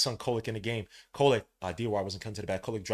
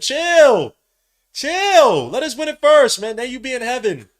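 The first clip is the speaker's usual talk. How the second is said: excited high yelling; 10 semitones higher, 12 dB louder than usual. Real, not acted. Yelling at an overexcited friend.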